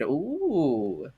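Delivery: ordinary talk, nothing marked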